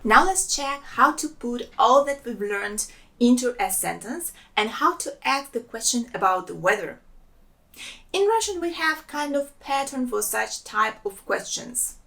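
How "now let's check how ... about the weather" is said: normal talking voice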